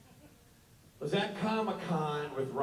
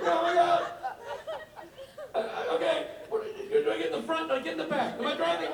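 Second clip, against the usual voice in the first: high, loud mock panic; panicked Rob; 12 semitones higher, 3 dB louder than usual. Friend narrating the cab to Rich, high and frantic. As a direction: shriek it like a lost drunk friend.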